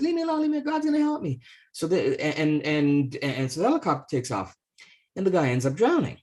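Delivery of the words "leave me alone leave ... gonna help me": stubborn refusal. Acted. Priest refuses the helicopter.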